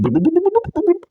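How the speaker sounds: burbled underwater noises jumping high; imitated bubbling; mock drowning bubbles. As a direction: burble like someone face down in a pool.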